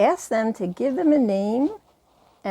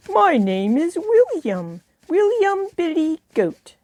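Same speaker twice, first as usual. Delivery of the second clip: high, loud goat puppet; billy goat voice; 7 semitones higher, 3 dB louder than usual. Shown as a demonstration. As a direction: goat introducing himself proudly. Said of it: "make the voice different from their own".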